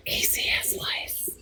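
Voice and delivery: hushed secret whisper; breathy voiceless whisper. Acted. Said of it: "I have to whisper this".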